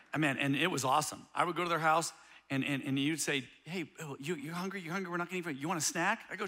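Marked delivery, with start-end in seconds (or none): 3.6-6.2 coaxing offer. Retold or imitated. Grandma gently offers food.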